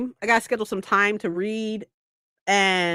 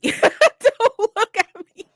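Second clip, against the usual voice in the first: high, slow, laughing mock plea; 11 semitones higher, slower than usual. Avoiding locals at the cemetery, pleading through laughter. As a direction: stretch it out, laughing. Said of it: "I was like".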